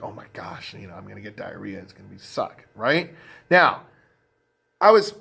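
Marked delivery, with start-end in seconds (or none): 0.0-2.6 hushed, whispered inner panic; whispered worry. Retold or imitated. Worrying to himself about getting sick in Mexico, whispered. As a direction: breathe the fear out under your breath.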